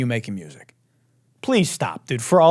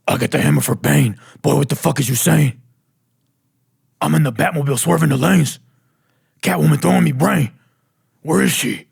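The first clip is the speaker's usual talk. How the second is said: gravelly Batman voice rapping; 2 semitones higher, 4 dB louder than usual. Retold or imitated. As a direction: growl it like Batman doing drill.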